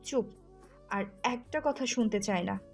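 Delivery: stern, cross command; angry silencing. Acted. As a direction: cut them off in anger. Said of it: "সাথে সাথে থাপ্পড় মেরে বলল".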